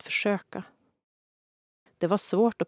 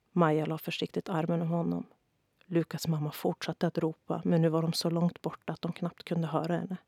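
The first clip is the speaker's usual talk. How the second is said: plain reading voice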